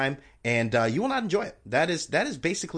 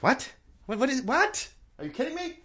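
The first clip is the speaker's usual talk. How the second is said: pitched way up, stammering in disbelief; shocked disbelief; 12 semitones higher than usual. Retold or imitated. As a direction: caught off guard by the bill. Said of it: "I was like".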